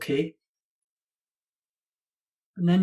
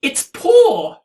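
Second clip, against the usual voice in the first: much higher, louder mock teacher correcting a boy; imitating a prim teacher; 11 semitones higher, 8 dB louder, slower than usual. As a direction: ham up a fussy teacher's correction. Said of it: "My teachers corrected me".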